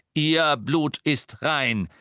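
slow, loud pronouncement; booming. A narrator's reading. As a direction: boom it impressively across a hall. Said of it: "seine Stimme dröhnte eindrucksvoll".

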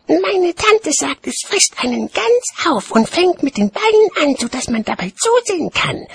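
put-on very high-pitched little-kid voice; chattering child. A narrator's reading. Child repeating aunt's threat to watchman, high childish chatter. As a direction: prattle on like a small child. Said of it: "plauderte das Kind".

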